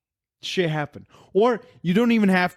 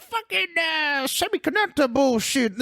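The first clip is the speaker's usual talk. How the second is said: mock wail pitched way up; hammed-up incantation; 12 semitones higher than usual. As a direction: squeal it like a silly cartoon spellcaster. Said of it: "She goes".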